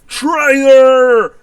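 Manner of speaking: high, drawn-out outraged cry; shocked yell. Acted. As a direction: yell it at a betrayer, full force. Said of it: "with a shock on his face and yells".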